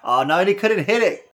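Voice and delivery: high, fast excited commentary; excited call. Real, not acted. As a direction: shout out the finisher. Excited call of big moves.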